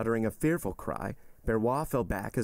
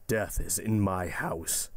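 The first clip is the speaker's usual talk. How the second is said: slow, understated cry of dismay; stricken cry; slower than usual. A narrator's reading. Magistrate laments death in his house, slow and stricken. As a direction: let the dread weigh down each word. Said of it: "cried Villefort".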